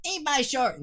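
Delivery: high cartoon boy voice; Bart Simpson voice. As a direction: toss it off like a cheeky cartoon kid. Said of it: "do Bart Simpson".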